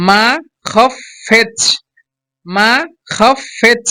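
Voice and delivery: "মাখাফেচ" very slowly as মা, খা, ফেচ; syllable-by-syllable pronunciation. Shown as a demonstration. Teacher has learners copy the pronunciation, "মাখাফেচ" slowly in separate syllables. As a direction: split "মাখাফেচ" into three drawn-out, separate syllables. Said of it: "আমার মতো উচ্চারণ করতে হবে".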